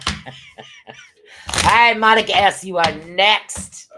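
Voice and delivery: loud, high announcer-like summons; calling out. Real, not acted. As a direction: call the next name across the room. Calls up the next person.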